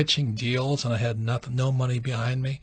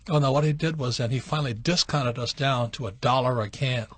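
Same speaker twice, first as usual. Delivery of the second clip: usual speaking voice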